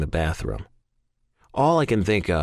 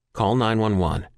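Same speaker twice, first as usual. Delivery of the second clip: plain reading voice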